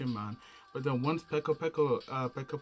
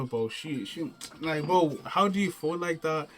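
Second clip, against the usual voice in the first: ordinary talk, nothing marked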